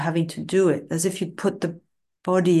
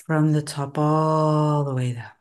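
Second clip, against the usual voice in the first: ordinary delivery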